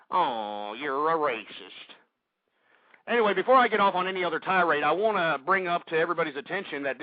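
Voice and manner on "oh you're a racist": low, drawn-out mock reproach; mocking accusation. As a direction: drag it out slowly and deep in ridicule. Mimics liberals accusing him of racism.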